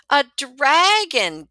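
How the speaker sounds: loud, slow amazed gasp. A narrator's reading. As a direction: gasp in wonder. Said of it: "gasp Sam and Willa".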